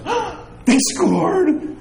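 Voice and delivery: high, drawn-out gasp of alarm; mock horror. Fan watching the recorded game, mock horror. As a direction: cry out in hammed-up dismay.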